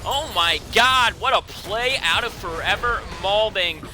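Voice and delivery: rising to a shout at the big play; hype play-by-play. Real, not acted. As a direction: cast it like the crowd's on its feet. Hype casting of a teamfight.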